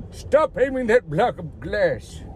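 slow, puzzled mock voice of old folks at the gym; confused old gym-goers. Old gym-goers scolding the camera, slow puzzled mock voice. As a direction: drawl it out like a baffled old-timer. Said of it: "We're like".

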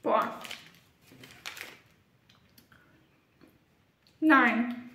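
chewing while talking; words around a bite; mouth full. Real, not acted. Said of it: "mit vollem Mund gesprochen".

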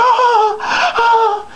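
high falsetto mock moaning; hammed-up wailing "oh" as a distraught woman; imitated woman's sobs, very high-pitched; crying woman. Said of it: "she's still over me crying".

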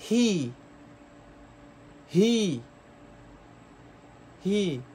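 he said as "hee" with clear h; close English he. Shown as a demonstration. Teacher repeats he slowly for students. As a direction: breathe the h in he. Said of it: "Vamos a pronunciar un poquito más de cerca".

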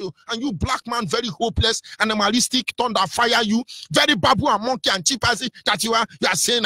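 ordinary talk, nothing marked

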